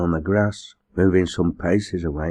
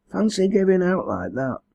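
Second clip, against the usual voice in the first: high-pitched, weakly amused exclamation; weak laugh; 13 semitones higher than usual. A narrator's reading. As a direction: lift the voice high in faint amusement. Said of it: "she laughed weakly".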